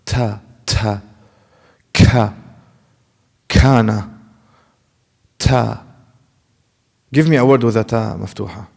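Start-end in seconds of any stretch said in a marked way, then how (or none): 0.0-5.8 Ta and ka with a strong puff of breath; airy burst after every T and K; mistaken hamis. Shown as a demonstration. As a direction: overdo the aspiration on each T and K. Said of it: "A lot of people do that".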